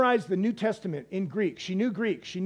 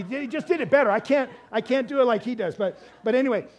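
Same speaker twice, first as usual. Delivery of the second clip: usual speaking voice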